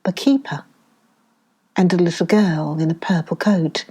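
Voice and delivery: ordinary delivery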